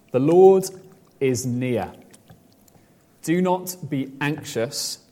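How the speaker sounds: slow, measured verse with long pauses; scripture recitation. Real, not acted. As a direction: recite phrase by phrase, unhurried.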